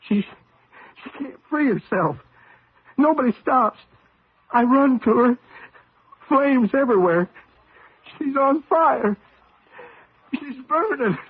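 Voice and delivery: distraught, near breaking as she burns; rising panic. Acted. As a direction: pitch climbing high as the horror builds. Retelling a nightmare of wife burning.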